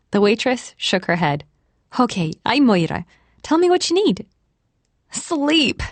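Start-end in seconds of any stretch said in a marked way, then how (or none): 2.0-4.3 Irish waitress, lifting high at the end; Irish accent. A narrator's reading. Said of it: "Her Irish accent added a note". 5.1-5.8 called out. A narrator's reading. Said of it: "Charlotte called out".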